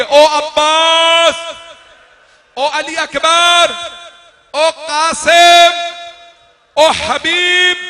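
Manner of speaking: high, very slow, shouted names; calling names. A narrator's reading. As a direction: stretch each name out in a desperate shout. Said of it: "फिर मौला ने नाम लिए". (हर नाम को खींचकर ऊँची पुकार)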